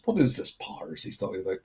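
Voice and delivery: imitating someone confused, under the breath; puzzled listener. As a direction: mumble it like a baffled listener. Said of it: "nobody knows what I'm talking about".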